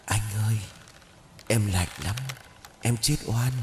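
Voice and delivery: soft, eerie ghost voice; ghostly moaning. Acted. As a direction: moan it like a ghost. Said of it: "một tràng thanh âm não nề".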